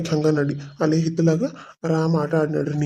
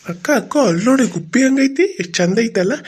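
high, bright little brother calling his big sister; excited little boy; 5 semitones higher than usual. Acted. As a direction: point something out to her, delighted.

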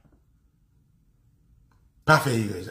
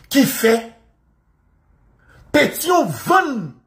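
slow, high, loud outcry; slow indignant yell; outraged shout; 9 semitones higher, 4 dB louder, much slower than usual. Real, not acted. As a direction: stretch each word out in outrage. Angry rant about Pétion.

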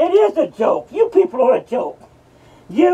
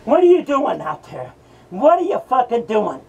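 ordinary delivery

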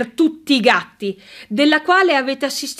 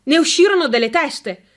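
usual speaking voice

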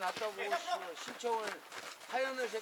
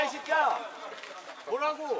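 shouting; 4 semitones higher, 6 dB louder, slower than usual. Real, not acted. Yelling at player on field.

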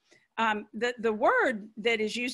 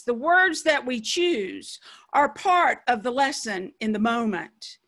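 normal talking voice

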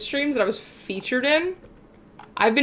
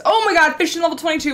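excited shout; 8 semitones higher, 7 dB louder, faster than usual. Real, not acted. Excited at fishing level.

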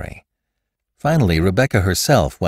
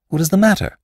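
high-pitched alarmed query; sudden, raised-pitch concern; startled question; 13 semitones higher, 4 dB louder, faster than usual. A narrator's reading. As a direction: ask it high and taken aback. Startled by the woman's violent start.

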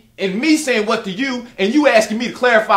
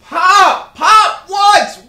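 loud high shout; 8 semitones higher, 8 dB louder, much slower than usual. Acted. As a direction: holler for your father. Son shouts to father.

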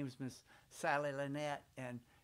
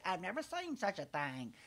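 higher, louder fussy lady; Miss Sally Lynette voice; 5 semitones higher, 5 dB louder than usual. Retold or imitated. Miss Sally Lynette frets over the boy's blue mouth, higher and fussy. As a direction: fret like a prissy visiting lady. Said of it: "she would say".

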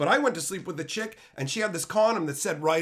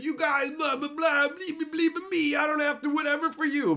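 high, hammed-up whiny ranting; mock rant voice; 11 semitones higher than usual. Streamer mocking his own rants to chat, high mock whine. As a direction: parody yourself ranting at viewers, way up high.

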